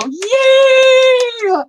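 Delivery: high, loud cheer of delight; real burst of joy, shouted up high; cheering a friend's good news; excited yay. Real, not acted. Cheering the frame arriving tomorrow.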